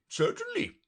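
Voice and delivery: 더 높이 올라가는 긴장한 왕 목소리; nervous king, pitch jumping way up. Acted. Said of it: "the king muttered".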